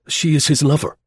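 German junior officer reporting; lieutenant explaining. Acted. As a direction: explain hastily to a superior. Lieutenant explaining to angry general.

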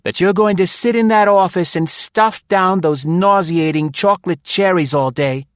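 high, loud, acid woman's voice; scornful nagging; acidly. A narrator's reading. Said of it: "said Maud acidly".

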